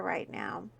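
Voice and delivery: hushed, confiding whisper. Real, not acted. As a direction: softly whisper the despair.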